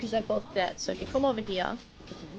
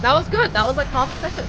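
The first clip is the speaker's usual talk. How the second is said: excited praise; 4 semitones higher, 8 dB louder than usual. Real, not acted. Praising the quick cast.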